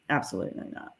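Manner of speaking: breathed out low and soft, nearly voiceless; muttered afterthought under the breath; whispered repeat. Real, not acted. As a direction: drop to a near-whisper for the echo. Emphatic denial repeated under breath.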